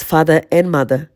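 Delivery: ordinary delivery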